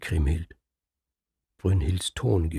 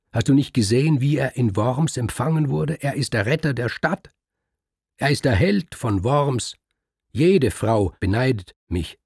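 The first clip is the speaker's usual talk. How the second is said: rising higher and louder with pride; indignant, proudly raised voice; proud defence; 5 semitones higher, 5 dB louder than usual. Acted. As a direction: boast about the hero husband, building. Kriemhild proudly defends her husband.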